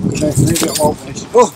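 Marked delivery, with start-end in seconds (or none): none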